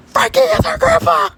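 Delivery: very high screaming excitement; piercingly high astonished shout; shrieked revelation. Acted. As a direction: shriek the news hysterically. Shocking discovery repeated, shrieked.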